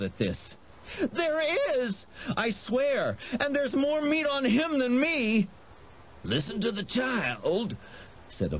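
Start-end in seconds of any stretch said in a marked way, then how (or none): 1.0-5.5 high, tearful insistence; desperate supplication. A narrator's reading. Wendell offers Harvey up to save himself, pleading. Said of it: "new round of supplications". 6.2-7.8 pitched-up lurking creature voice; sly goading. A narrator's reading. Jive prompts Harvey from the bushes, goading.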